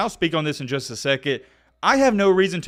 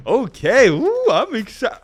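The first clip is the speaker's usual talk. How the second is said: excited; 8 semitones higher, 4 dB louder, slower than usual. Real, not acted. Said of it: "When I say excited".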